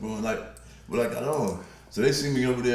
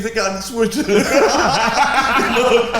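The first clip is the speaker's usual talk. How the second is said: laughing; 14 semitones higher, 13 dB louder than usual. Real, not acted. Laughing at girlfriend's remark.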